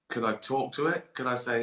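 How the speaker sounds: usual speaking voice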